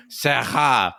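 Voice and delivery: "Sera" with a put-on French r; mocked French pronunciation. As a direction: imitate the hosts' Frenchified "Sera". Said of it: "every time the two of you utter".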